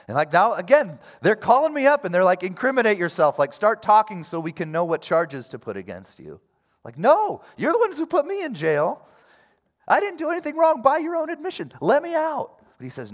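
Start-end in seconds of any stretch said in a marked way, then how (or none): 0.1-6.4 indignant rant, high and loud; acted angry outburst. Preacher imagining himself as the wrongly jailed Paul, angry. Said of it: "I'm just angry if I'm him". 7.0-12.6 very high, loud indignant outburst; acted angry protest. Prisoner demanding his captors let him out, angry. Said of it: "I'm just angry if I'm him".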